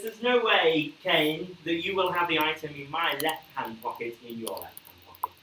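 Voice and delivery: ordinary talk, nothing marked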